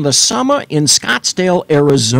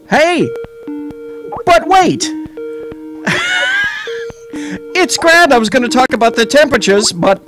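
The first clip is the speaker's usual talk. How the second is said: excited announcer; 14 semitones higher than usual. Pretend. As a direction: break in like an overexcited pitchman. Sudden hammed-up announcer burst.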